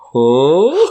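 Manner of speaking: loud, high, rising huh; mock astonishment. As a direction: imitate short guys gaping up at a giant. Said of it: "'cause they're like".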